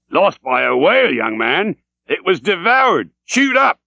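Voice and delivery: put-on an old whaler flaring up at the memory; fierce outburst. A narrator's reading. Captain bursts out fiercely.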